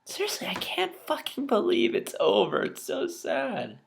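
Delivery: choked up with real tears; crying. Real, not acted. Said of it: "before I start tearing up".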